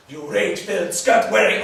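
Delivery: angry name-calling; hurling insults. Acted. Trading insults.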